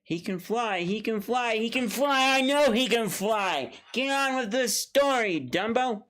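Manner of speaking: frustrated viewer yelling at the musical; building exasperated yell. Retold or imitated. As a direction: lose patience until you shout. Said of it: "here's why".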